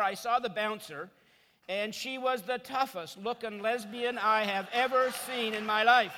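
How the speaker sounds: usual speaking voice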